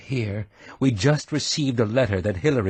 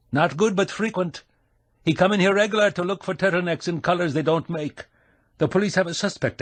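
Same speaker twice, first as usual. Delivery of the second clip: higher, hearty Scottish brogue; 8 semitones higher than usual. A narrator's reading. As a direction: roll out a shopkeeper's Scottish brogue. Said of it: "putting on the brogue".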